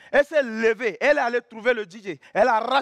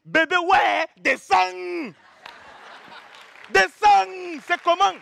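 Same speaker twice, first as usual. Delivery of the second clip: put-on higher, loud Cameroonian accent; 6 semitones higher, 3 dB louder, slower than usual. Telling girlfriend to get down, Cameroonian accent. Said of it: "c'est l'accent camerounais".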